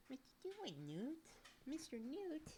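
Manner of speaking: very high, soft squeaky coo. Real, not acted. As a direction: coo at the cat teasingly. Baby talk to cat Newt.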